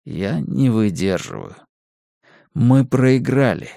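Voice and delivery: plain reading voice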